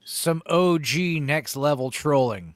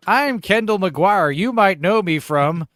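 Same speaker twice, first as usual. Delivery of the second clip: mock actress intro; 4 semitones higher, 8 dB louder, slower than usual. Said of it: "acting like somebody pretending to be an actress".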